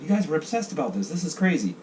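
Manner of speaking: high, exasperated voice; a lightly hammed-up outsider in disbelief; mock amazement. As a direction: marvel at how everyone moved on. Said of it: "I was like".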